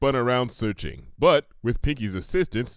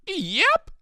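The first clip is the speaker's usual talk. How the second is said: very high chirp into a laugh; giggly yep; 5 dB louder than usual. Acted. Pinkie revealed to Applejack, very high giggling yep.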